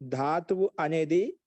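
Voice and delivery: slow phrases with drawn-out endings; slow dictation. Shown as a demonstration. Slow dictation for note-taking. (నెమ్మదిగా రాయించే స్వరం)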